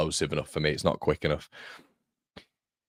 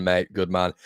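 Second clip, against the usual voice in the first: normal talking voice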